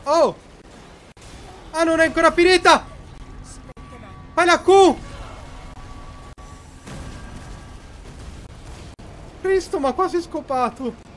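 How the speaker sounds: loud high fast panicked yelling; frantic shouting. Real, not acted. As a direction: scream orders in a panic. Frantic escape during game. (urla frenetiche)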